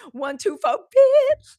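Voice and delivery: high, loud comic outburst; mock pleading the fifth. Host imitating AI Fauci videos, mock pleading the fifth. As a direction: count up to a piercing, high last word. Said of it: "the Doctor Fauci pleading the fifth".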